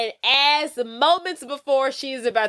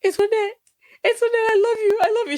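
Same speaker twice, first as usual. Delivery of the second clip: high pleading cry of "Antoinette, I love you"; hammed-up high dying declaration, mock sobbing; crying love plea; 11 semitones higher, 4 dB louder, much faster than usual. Dying hero begging his girlfriend, high mock sobbing.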